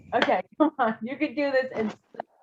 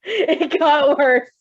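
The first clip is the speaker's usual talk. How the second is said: slow, higher laughing despair; laughing groan; 4 semitones higher, 4 dB louder, much slower than usual. Real, not acted. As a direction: drag it out through giggles. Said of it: "x0.4".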